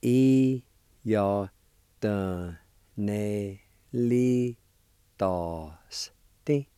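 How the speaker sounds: I-ya-de-ne-li-dos-ti spelled out very slowly in syllables; teacher breaking I-ya-de-ne-li-dos-ti down slowly; slow syllable-by-syllable Cherokee. Shown as a demonstration. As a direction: sound out I-ya-de-ne-li-dos-ti for beginners. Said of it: "It's pronounced like this".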